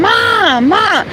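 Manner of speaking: high shouted call across a court. Retold or imitated. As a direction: yell it to get her attention. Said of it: "he was yelling and screaming".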